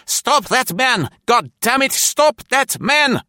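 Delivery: very high, loud, drawn-out yell; screamed order. A narrator's reading. Officer ordering troops to stop a runaway, screaming. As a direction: scream the command at everyone in earshot. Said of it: "screamed Bock".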